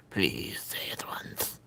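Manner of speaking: breathy, pleading whisper; sneaky little coaxing whisper. Real, not acted. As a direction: egg him on under your breath. Coaxing someone to say it.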